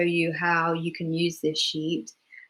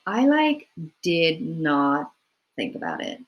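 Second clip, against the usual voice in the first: Los Angeles accent; slower than usual. Shown as a demonstration. Demo for a drama class of an LA speaker, LA accent with big pitch swings. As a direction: play it like a laid-back LA girl, pitch leaping on like, falling away on think about it. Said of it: "a very different intonation".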